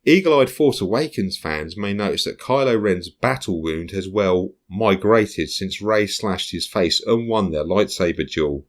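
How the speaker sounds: ordinary talk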